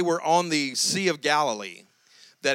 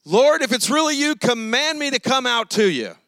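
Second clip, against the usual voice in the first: Peter pleading; 6 semitones higher, 4 dB louder than usual. Retold or imitated. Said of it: "And Peter says".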